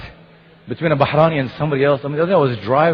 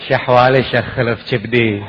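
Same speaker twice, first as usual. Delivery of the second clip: mock romantic Bahraini sweet talk; hammed-up Bahraini flirting; faster than usual. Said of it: "romantic conversation in Bahraini".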